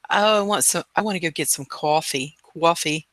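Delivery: mock New Jersey accent; playful Jersey accent with "cawfee" vowel. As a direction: imitate Jersey, rounding the vowel in "coffee". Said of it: "if you're from Jersey, you say".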